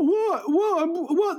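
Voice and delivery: high-pitched protesting repeats; imitating balking son. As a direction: play someone baffled and reluctant. Son balks at running over chips.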